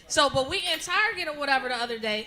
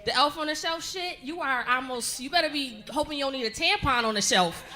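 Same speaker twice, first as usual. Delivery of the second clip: ordinary talk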